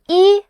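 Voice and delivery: "yi" high, level and a little strong; level high-pitched "yi"; high flat tone. Shown as a demonstration. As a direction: hold "yi" up on one flat note. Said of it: "The first tone has a flat line".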